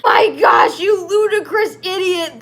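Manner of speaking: loud mock-furious shout; hammed-up indignant yell; angry shout. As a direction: bark it out in fake outrage. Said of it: "they both shouted angrily".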